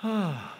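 deep breath let out in a sigh. Shown as a demonstration. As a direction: breathe out slowly and audibly. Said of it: "just take a deep breath".

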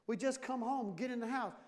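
normal talking voice

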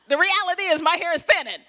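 mock indignant retort. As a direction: rattle it off in exaggerated offense. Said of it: "I wanted to look at her and say".

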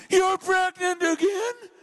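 hammed-up husband's dismay, pitched high; Jacob's reply. Jacob reacting to Leah's news, mock disbelief. Jacob answering Leah, acted.

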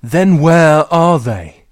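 loud slow demand; demanding shout. Acted. Girl shouts at her sister, loud and slow.

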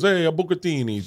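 put-on dad parroting an Italian accent; mimicked Italian accent. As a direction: imitate dad doing a bad Italian accent. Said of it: "do his accent back to him".

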